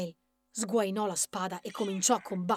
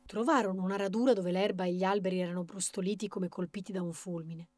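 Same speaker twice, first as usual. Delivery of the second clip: usual speaking voice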